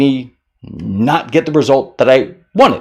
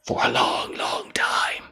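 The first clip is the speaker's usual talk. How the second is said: whisper; 10 dB quieter than usual. Acted. Dragging out dwelling.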